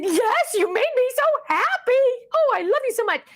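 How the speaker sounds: high, adoring coo; gushing delight. Retold or imitated. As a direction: re-enact gushing at a little grandchild. Retells delighted reply to grandchild.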